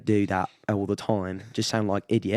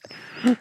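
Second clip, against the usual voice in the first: high breathy giggle in character; Bubbles giggle. Retold or imitated. Still in the Bubbles voice, a high giggle. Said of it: "He goes like this".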